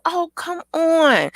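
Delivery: high, drawn-out kiddie plea; imitated pleading child. Child coaxing Queen to visit, pleading in high voice. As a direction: stretch it out like a begging child. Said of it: "She said".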